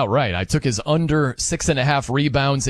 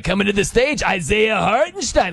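strip club announcer; 3 semitones higher than usual. Retold or imitated. Host imitating the OKC announcer introducing a player, strip club announcer voice. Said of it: "He sounds like a strip club announcer".

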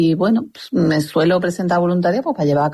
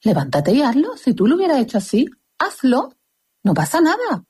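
réplica indignada; 7 semitones higher than usual. Retold or imitated. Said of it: "Y tú dices".

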